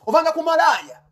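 loud, high accusing outburst; angry scolding. Real, not acted. Angry rant insulting a rival.

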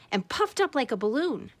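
plain reading voice

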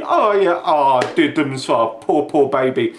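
mock the dad's fake sympathy; a hammed-up sneering sympathy; mock pity. Mimics the dad's sarcastic pity.

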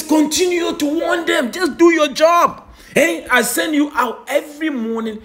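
loud, high shout of command; commanding Lord. Retold or imitated. The Lord commanding his prophet, shouting. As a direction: order him to keep going.

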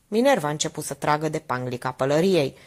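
plain reading voice

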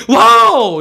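big, loud "Wow!"; teacher's model cry of amazement; demonstrated exclamation. Shown as a demonstration. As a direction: show how to exclaim it, high and loud. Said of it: "이렇게 해야지".

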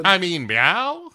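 high cat meow, rising at the end; questioning meow. Acted. Cat pranking its owner, playful questioning mew.

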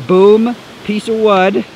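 ordinary delivery

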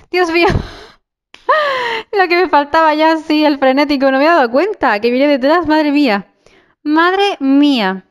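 high, loud flustered panic; breathless fright while fleeing; panicked. Real, not acted. Frenetic zombie chasing her.

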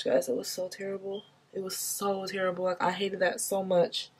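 hushed, sorrowful telling of the clinic; quiet heartfelt sadness. Real, not acted. Recalling the sad abortion clinic.

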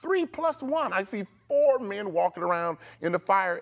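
high, stammering amazement; retold king counting in disbelief; astonished king. King seeing a fourth man in the furnace, astonished. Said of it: "He said".

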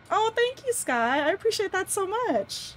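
high, bright gushing thanks; delighted thanks. Real, not acted. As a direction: gush your thanks to chat. Thanking a chat donor.

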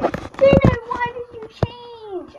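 squeaky imitated fan question; high mock viewer voice. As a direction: mimic a curious viewer, high and drawn out. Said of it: "you guys are probably asking".

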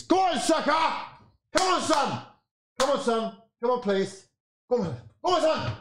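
high, pleading shouts at the player; will him on from the sofa; rising, desperate encouragement; urgent cheering. Real, not acted. Urging Saka on during attack.

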